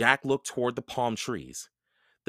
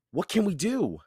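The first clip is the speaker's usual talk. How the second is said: put-on higher, small girl's question; worried girl; 9 semitones higher than usual. A narrator's reading. Annie asks.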